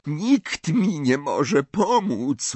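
put-on high, slow, shaking boy's voice; trembling Malfoy refusing help; trembling despair. A narrator's reading. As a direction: let despair shake every word. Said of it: "dygocąc na całym ciele".

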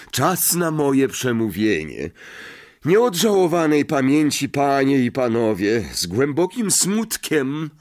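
grand, mournful address; ceremonial speech. A narrator's reading. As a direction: declaim it pompously to the guests. Nick begins his solemn public speech.

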